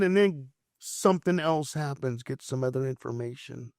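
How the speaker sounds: ordinary talk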